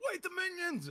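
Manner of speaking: high-pitched retold shout of alarm; imitated yell. Player retelling a teammate's sudden warning, imitated yell. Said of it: "someone yelled, like".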